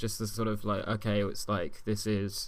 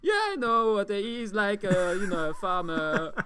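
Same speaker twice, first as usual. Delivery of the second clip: dim footballer voice; 6 semitones higher, slower than usual. Pretend. Mocking Anelka as dim to questioning officials, high dopey voice. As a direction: play him as cheerfully clueless.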